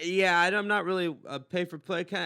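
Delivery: loud, stammered mock refusal; fumbling for words. Man declining woman's paid offer, fumbling for words. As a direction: stumble awkwardly through the excuse. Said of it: "kinda fumbling on my words".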